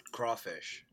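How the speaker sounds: ordinary talk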